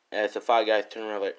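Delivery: usual speaking voice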